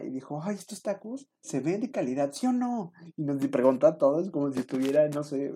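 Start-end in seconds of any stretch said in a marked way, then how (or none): 0.4-2.9 chatty lady. Retold or imitated. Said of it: "muy platicadora".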